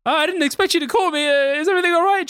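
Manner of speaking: high, anxious phone voice; insecure actor. Retold or imitated. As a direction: play yourself nervously taking a work call. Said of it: "anytime anyone in acting world calls me".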